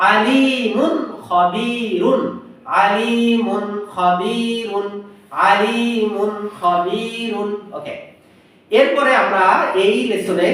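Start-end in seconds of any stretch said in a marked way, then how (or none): 0.1-7.5 আলিমুন খবিরুন very drawn out; slow recitation. Shown as a demonstration. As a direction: chant আলিমুন খবিরুন slowly, clear n. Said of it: "খ দিয়ে".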